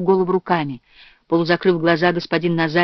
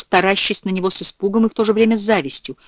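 ordinary delivery, nothing marked

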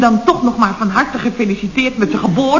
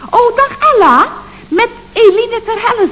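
hoog en luider, jubelend begroeten; overdreven blije groet; 10 semitones higher, 4 dB louder than usual. Acted. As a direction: begroet een oude vriendin aan de telefoon, overdreven blij. Overdreven vrolijke telefoongroet. (hoge, opgetogen telefoonstem)

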